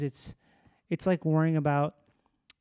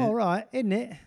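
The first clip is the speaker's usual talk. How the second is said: mock British; 4 semitones higher than usual. Mocking British sentence tags.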